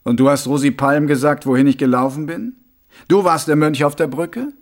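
suspicious watch commander. Acted. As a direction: interrogate the monk with force. Voices Mumm questioning the monk.

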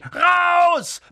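very high, loud bellow; roaring. Acted. Uncle throwing both boys out, furious roar. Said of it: "brüllte Onkel Vernon".

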